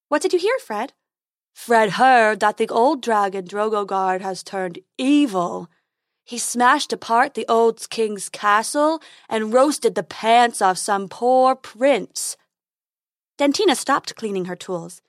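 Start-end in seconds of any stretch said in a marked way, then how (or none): 0.1-0.9 high, sweet fairy voice; tooth fairy voice. Acted. 1.5-12.4 slow, loud silly unicorn. Acted. Unicorn gossiping.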